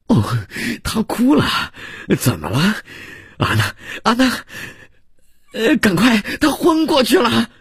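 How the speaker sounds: high-pitched panicked calling out; alarmed cry. Acted. Host calls his wife as guest faints, alarmed cry. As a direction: cry for help, climbing to a frantic shout.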